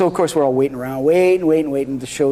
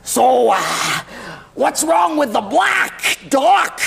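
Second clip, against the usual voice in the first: much higher, slow, overacted shout; hammed-up Mickey Rooney; 6 semitones higher, much slower than usual. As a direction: ham it up like a B-movie actor. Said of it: "His acting is like this".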